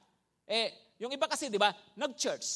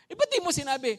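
louder, higher, baffled officer; officer scolding the soldier in disbelief; exasperated officer; 8 semitones higher, 6 dB louder, faster than usual. Retold or imitated.

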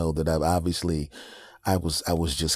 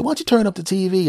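sly sibling; 13 semitones higher, 5 dB louder, faster than usual. Retold or imitated. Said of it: "And they'll say".

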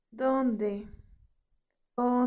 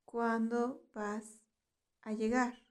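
"¿Cuándo vas a llegar?" slowed, with a pause before "a llegar"; 3 dB quieter than usual. Shown as a demonstration. Teacher repeats phrase slowly for learners.

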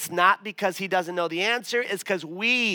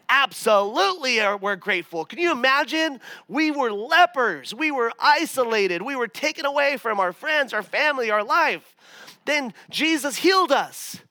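higher, louder grateful exclaiming; excited healed man; 7 semitones higher, 5 dB louder than usual. Pretend. Healed leper telling a disciple how grateful he is, excited. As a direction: burst out with thrilled thanks.

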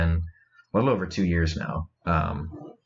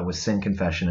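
ordinary delivery

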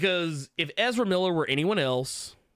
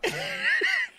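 high burst of laughter. Real, not acted. Partner struggling not to laugh.

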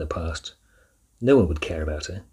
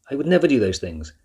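louder, higher protest; shouted denial; 7 semitones higher, 7 dB louder than usual. A narrator's reading. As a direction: keep shouting, hurt and defiant. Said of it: "shouted Rosa".

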